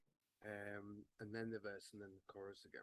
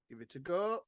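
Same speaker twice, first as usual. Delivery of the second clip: ordinary talk, nothing marked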